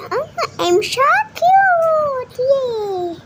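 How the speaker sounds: high, slow cooing gush. Real, not acted. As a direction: coo it, delighted with herself. Gushing slowly over herself.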